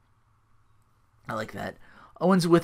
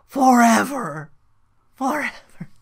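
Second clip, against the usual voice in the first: drawn-out, high mock proclamation; Bray Wyatt impression; 9 semitones higher, 5 dB louder, slower than usual. Imitating a wrestler's promo, high drawn-out proclamation. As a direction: stretch the word out, then echo it higher. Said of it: "Bray Wyatt with his family says".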